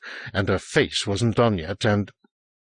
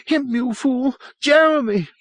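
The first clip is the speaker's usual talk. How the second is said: very high, furious outburst; crying aloud. A narrator's reading. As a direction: cry out hysterically at a fool. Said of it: "she cried aloud".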